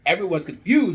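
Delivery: usual speaking voice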